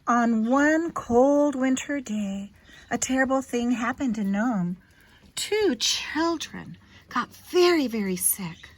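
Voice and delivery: gentle storybook read-aloud; clear, expressive storyteller voice for a little one; reading to a child. Acted. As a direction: read it like a bedtime picture book. Reads picture book to child.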